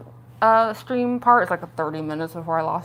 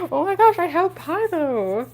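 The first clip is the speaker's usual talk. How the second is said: excited; 9 semitones higher than usual. Real, not acted. Excited about her pie.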